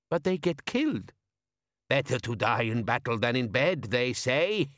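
put-on an old woman teasing the girls. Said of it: "a cackle from a very old woman".

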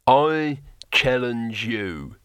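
slow, weighty declaration; drawn-out heroic defiance; bold challenge. A narrator's reading. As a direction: throw down the challenge, word by word. Hero issues a formal challenge.

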